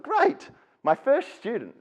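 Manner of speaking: high, slow, wide-eyed eagerness; a hammed-up keen new teacher; mock eager. New teacher entering his first class, mock eager and high.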